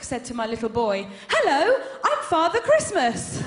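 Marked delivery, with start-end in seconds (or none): 1.3-3.4 high, loud, flustered greeting; panicked chap. Retold or imitated. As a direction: blurt it out caught naked on the landing. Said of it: "in a panic".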